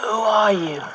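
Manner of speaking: parched call to a stranger. Acted. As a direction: call out loud and high across the beach. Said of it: "Arthur calls out".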